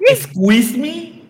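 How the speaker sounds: high, slow, offended put-on voice; mock offended.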